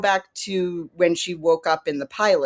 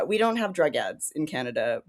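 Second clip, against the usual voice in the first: normal talking voice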